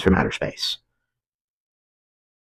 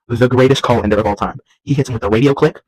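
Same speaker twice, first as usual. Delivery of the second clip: normal talking voice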